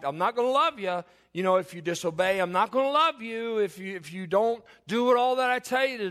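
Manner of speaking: mock demanding love. Voicing a conditional, demanding love.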